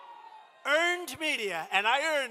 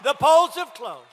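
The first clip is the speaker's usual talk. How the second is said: mock announcer; 6 dB louder than usual. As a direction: boom it out like a TV host calling the winner. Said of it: "they said, "Ladies and gentlemen".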